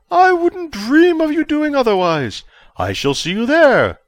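high, slow, polite official voice; formal chancellor. A narrator's reading. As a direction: ask graciously, like a pompous host. Verbidi gracious reply.

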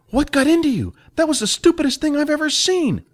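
high scolding voice for Jack; stern older companion. Acted. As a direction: scold him like a worried mentor.

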